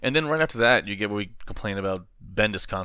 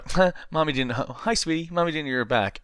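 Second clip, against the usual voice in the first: higher, breathless sweet mom voice; mock sweet mommy; 6 semitones higher than usual. Podcaster mocks Lois cooing at her son right after strangling. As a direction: coo it like a doting mother after choking. Mocking Lois's sweet line.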